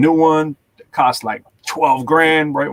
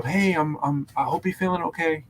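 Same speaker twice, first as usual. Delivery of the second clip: soft, gentle check-in; talking to mom; 2 semitones higher, 6 dB quieter than usual. Retold or imitated. Son checks on his mom, soft gentle voice. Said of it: "you're talking to your mom".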